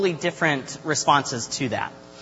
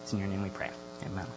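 softer, lower prayer voice, fading quieter; spoken prayer; 6 semitones lower, 8 dB quieter than usual. Real, not acted. Youth leader praying for the group before teaching, hushed prayer. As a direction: pray quietly, sinking lower toward the amen.

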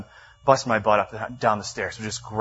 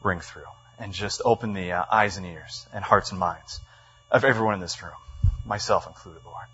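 lower, subdued praying voice; prayer; 4 semitones lower than usual. Real, not acted. Leading the room before the text, in low prayer. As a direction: pray aloud in a low, humble tone.

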